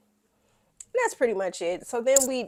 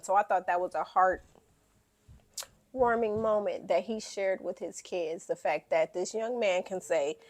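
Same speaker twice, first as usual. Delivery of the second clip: ordinary talk, nothing marked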